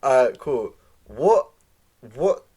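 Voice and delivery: ordinary talk, nothing marked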